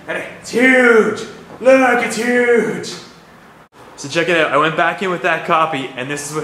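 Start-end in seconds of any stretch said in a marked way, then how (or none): 0.1-2.9 slow, loud, high marvel at how huge; amazed at size. Real, not acted. Vlogger showing viewers the giant card copy, drawn-out awe. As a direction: draw out huge like a proud reveal.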